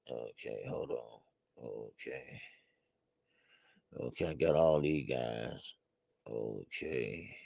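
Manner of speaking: very quiet and low, almost under the breath; talking to himself. Real, not acted. Host checking who is in the chat, absent murmur to himself.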